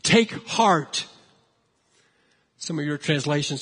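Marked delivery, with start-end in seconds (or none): none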